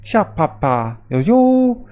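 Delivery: odd character call, leaping high at the end; weird catchphrase. Acted. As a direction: sound out a silly catchphrase, pitch shooting up. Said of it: "they're weird. It's like".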